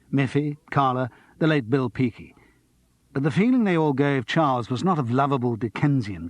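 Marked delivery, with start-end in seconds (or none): none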